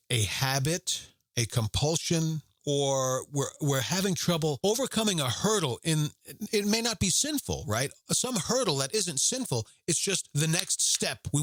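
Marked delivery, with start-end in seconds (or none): none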